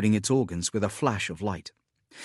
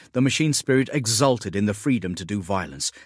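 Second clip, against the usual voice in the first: ordinary delivery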